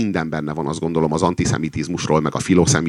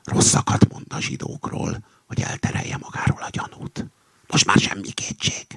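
suspicious, hushed stage whisper; 3 semitones higher than usual. A narrator's reading. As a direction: whisper the proof to an ally. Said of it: "súgta oda a kakas". (gyanakvó suttogás)

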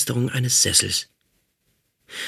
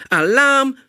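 high, loud alarm cry; robot screams alarm; 6 dB louder, slower than usual. Acted. Robot captor raising the alarm to the others, loud high scream. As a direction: shout the alarm at full pitch. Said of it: "schrie er".

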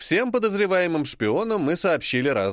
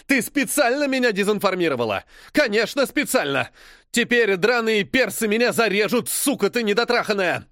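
higher, shrill furious screech; shrieking; 7 semitones higher, 3 dB louder than usual. Acted. British spy shrieking accusations at the journalist. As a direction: screech the accusations without listening. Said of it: "визжит на своей волне".

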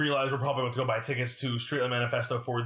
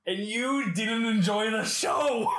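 teasing shout; 6 semitones higher, much slower than usual. Real, not acted. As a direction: call it out slowly to tease. Teasing co-host's confession.

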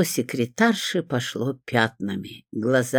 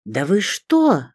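high, slow, thrilled disbelief; fluttering awe; 6 semitones higher, slower than usual. A narrator's reading. Secretary offered an introduction to her idol, fluttering. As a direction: stretch it out in starstruck amazement. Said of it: "затрепетала секретарша".

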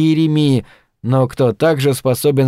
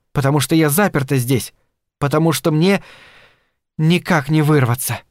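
plain reading voice

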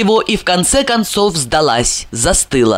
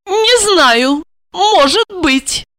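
high, hesitant woman's voice; waver, drawing it out uncertainly; doubtful; 11 semitones higher, much slower than usual. Acted. Wife starts to consider his offer, doubtful. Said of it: "В её голосе появилось сомнение".

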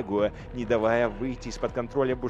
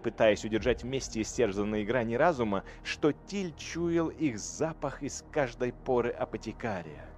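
plain reading voice

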